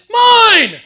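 very loud, high shout of Mine, laughing; shouted toddler mine. Retold or imitated. Wife quoting their son's real first word, shouted high. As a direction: bellow it like a toddler grabbing a toy. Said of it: "Yesterday he said".

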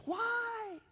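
very high, stretched "Why?"; high-pitched rhetorical why; incredulous question. Shown as a demonstration.

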